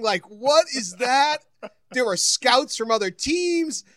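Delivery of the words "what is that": retold scream. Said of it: "everyone's screaming".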